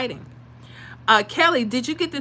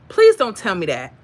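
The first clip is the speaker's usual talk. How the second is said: louder, pained plea; pleading; 8 dB louder than usual. Real, not acted. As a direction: beg them not to say it. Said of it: "I'm about to start crying".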